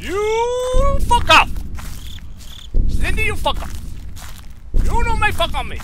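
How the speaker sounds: frantic angry yell at the enemy. Real, not acted. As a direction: drive it away furiously. Yells at the monster.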